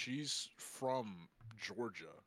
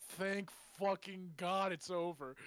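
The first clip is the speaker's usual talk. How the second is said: mock scream; 8 semitones higher, 4 dB louder, slower than usual. Drunk guy screamed.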